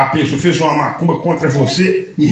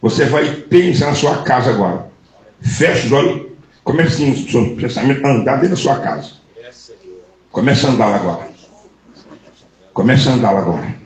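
lower, hushed guiding voice; guided visualisation; 8 semitones lower than usual. Acted. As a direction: lead her calmly into her own house in her mind. Guides her to close eyes.

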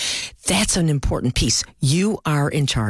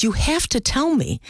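urgent plea; 8 semitones higher than usual. Retold or imitated. Pleading with dying grandmother.

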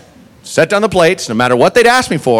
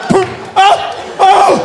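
very high, startled yelps; mock pained cries; 14 semitones higher, slower than usual. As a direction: squeal like you just got hit. Diner hit by a plate.

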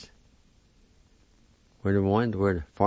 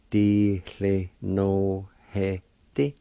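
slow demo; much slower than usual. Shown as a demonstration. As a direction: Di cle no he di said very slowly. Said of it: "It's pronounced like this".

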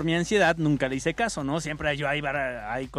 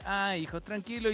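soothing mom; 3 semitones higher, 7 dB quieter than usual. Retold or imitated. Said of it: "Me dice".

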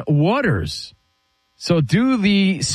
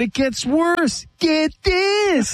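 very high-pitched gossip hook; excited teaser; 12 semitones higher, much slower than usual. Real, not acted. Host announcing the scandal gets worse, excited high teaser. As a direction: squeal it as a running catchphrase.

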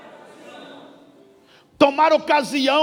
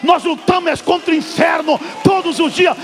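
shouted, rising sermon rebuke; fiery preaching; 5 semitones higher, 4 dB louder than usual. Acted. Preacher shouting down fear of the devil, fiery sermon voice. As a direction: thunder at the congregation's fear.

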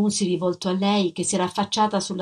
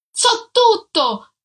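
loud, very high, slow furious yell; shouted; 13 semitones higher, 5 dB louder, much slower than usual. A narrator's reading. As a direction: hurl it at her. (urlato con rabbia)

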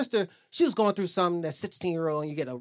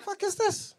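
scolding parent; 12 semitones higher than usual. Retold or imitated.

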